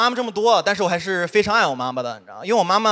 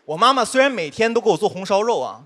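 normal talking voice

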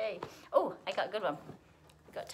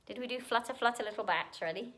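plain reading voice

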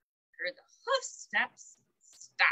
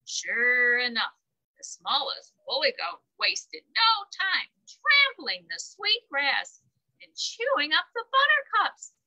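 usual speaking voice

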